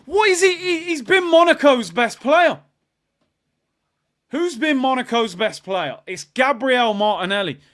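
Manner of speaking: high, loud incredulous rant; exasperated outburst. Real, not acted. Fan ranting at Martinelli giving the ball away, exasperated outburst. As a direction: raise the voice in sudden exasperation.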